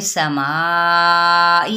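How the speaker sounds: समाई very slowly, long middle vowel; joined long madd. Shown as a demonstration. Teacher shows madd on समाई, slow and long. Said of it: "मद्दे मुत्तसिल करेंगे".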